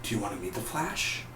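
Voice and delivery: very soft, secretive question; hushed offer. Retold or imitated. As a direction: drop to a near-whisper, rising at the end. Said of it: "I really want him to be like".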